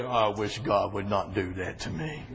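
low, softened reluctant voice; mock complainer. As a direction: lightly imitate someone grumbling.

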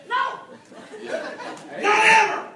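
high, insistent no; acted-out firm denial; emphatic denial. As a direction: pitch it up and rule it out completely.

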